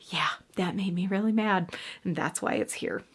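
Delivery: hushed aside whisper. Real, not acted. Whispering to viewers about the book. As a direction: drop to a breathy whisper aside.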